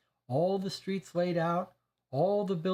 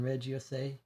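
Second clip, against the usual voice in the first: ordinary delivery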